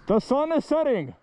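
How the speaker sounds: high-pitched call to friends. Real, not acted. Calling out to friends about sunset.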